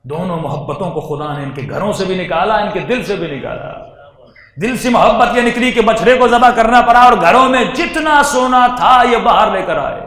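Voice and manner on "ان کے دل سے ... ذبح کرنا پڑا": usual speaking voice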